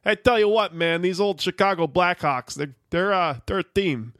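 put-on folksy Midwestern coach talk in a Chicago accent; hammed-up Chicago accent like a football coach. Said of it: "how to do Chicago accent. Just do John Gruden".

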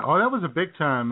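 ordinary talk

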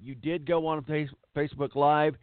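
ordinary delivery

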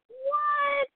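very high, stretched-out what; thrilled astonishment; shocked. Retold or imitated. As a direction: squeal it in disbelief. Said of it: "I was like".

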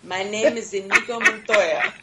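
dramatic laughing movie quote; Inigo Montoya. Retold or imitated. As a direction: belt out the famous line, half laughing. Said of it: "He says".

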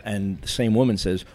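ordinary talk, nothing marked

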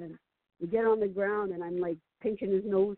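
ordinary talk

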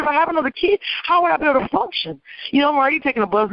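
high-pitched, indignant questioning; incredulous protest. Retold or imitated. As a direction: argue back in disbelief, pitched well up. Retells protesting to the caseworker.

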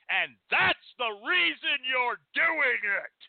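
high, drawn-out angry accusation; real anger. Real, not acted. Speaker accusing frauds of discouraging reading, really angry.

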